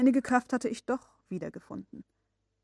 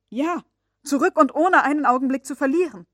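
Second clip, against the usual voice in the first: urgent cry; 5 semitones higher, 11 dB louder than usual. Acted. Nephew urges immediate return.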